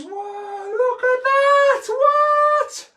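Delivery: very high, loud, slow stretched gasp; mock amazement. Amazed at his own trick for kids, high and loud. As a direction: go huge with fake wonder.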